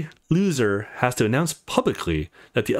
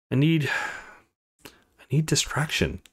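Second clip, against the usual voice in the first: desperate whisper; 3 semitones higher than usual. Acted. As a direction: let it slip out as a whisper. Admitting his desperate need.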